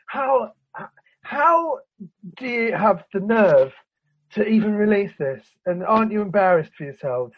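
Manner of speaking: high, halting, appalled disbelief; incredulous outrage. Acted. Viewer confronting the makers of an awful film, incredulous outrage. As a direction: demand an answer, dragging out the words in disbelief.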